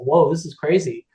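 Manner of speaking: usual speaking voice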